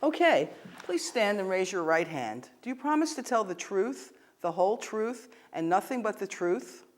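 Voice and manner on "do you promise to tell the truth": ordinary talk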